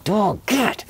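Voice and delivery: high, sharp yell of "Dog, get!"; high shout to drive the dog off. Retold or imitated. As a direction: yell it while staring the dog down. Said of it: "I'm, like, full on eye contact".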